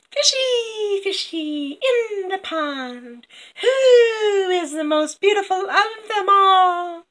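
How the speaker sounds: high, slow, crooning villainess; Silver Tree. Acted. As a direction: coax the fish in a drawn-out, high sing-song. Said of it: "And she says".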